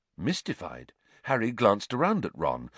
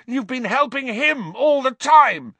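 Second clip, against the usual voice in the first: yell; 9 dB louder than usual. A narrator's reading. Harry yells at Lupin in fury, high and very loud. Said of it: "Harry yelled".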